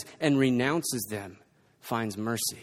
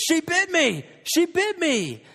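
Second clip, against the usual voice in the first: much higher imitated child's wail; screaming child; 13 semitones higher, 3 dB louder, slower than usual. Child running to parents screaming about being bitten. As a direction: wail it like a bitten little kid. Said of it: "comes running out of the room screaming".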